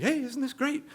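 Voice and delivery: cheerful grin, pleased with the night; grinning. Retold or imitated. Francis in the water beside him, grinning and cheery. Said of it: "he's all grinning".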